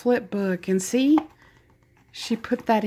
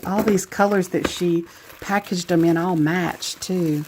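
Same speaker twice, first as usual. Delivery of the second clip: normal talking voice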